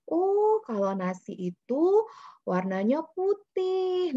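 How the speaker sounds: put-on high, slow teaching voice for a toddler; parent to toddler. Shown as a demonstration. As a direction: explain slowly to a little child. Talking to a toddler, demo.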